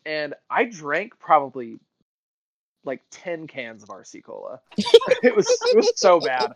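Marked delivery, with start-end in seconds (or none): 4.8-6.6 high laughing through the words; real giggling. Real, not acted. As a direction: laugh it out in disbelief. Laughing about ten cans.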